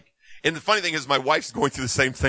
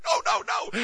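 high denial. Retold or imitated. Retold emotional denial.

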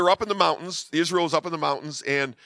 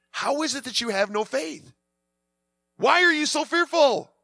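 incredulous rebuke; 9 semitones higher than usual. Retold or imitated. Acting Jesus questioning the stunned disciples.

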